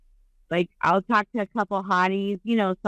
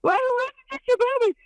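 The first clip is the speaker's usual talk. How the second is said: much higher, laughing mock disbelief. Reacting to a friend's boob job offer, high laughing mock shock. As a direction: blurt it out in pretend shock, pitched way up. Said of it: "And I was like".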